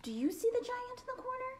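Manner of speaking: high, eager questions; thrilled listener voice; excited questioning. Acted. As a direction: pester excitedly about the giant.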